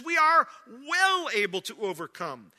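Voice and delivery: "well" drawn out and loud; emphatic confidence. Acted. Caleb filled with trust in God, loud stress on "well". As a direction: lean hard on "well". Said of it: "repeated back to back for emphasis".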